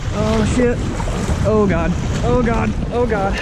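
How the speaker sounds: high, panicked oh God; panicked exclamations. Real, not acted. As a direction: gasp it out in alarm. Almost capsized.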